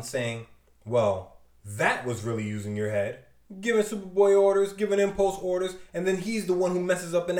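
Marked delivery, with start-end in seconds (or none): 0.8-3.2 low mocking sarcasm. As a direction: drop the voice and mock him. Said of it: "Superboy mocking Robin".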